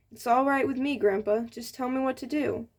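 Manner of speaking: usual speaking voice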